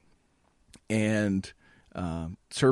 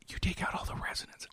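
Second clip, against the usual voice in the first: true whisper with no voice; 14 dB quieter than usual. Shown as a demonstration. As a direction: keep whispering, no tone at all. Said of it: "it's more like this".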